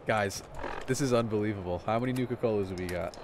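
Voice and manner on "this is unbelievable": ordinary talk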